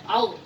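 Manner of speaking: "Al" said as if swallowing; swallowed Arabic letter. Shown as a demonstration. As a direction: swallow the "Al" sound. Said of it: "kita membacanya seperti menelan ya".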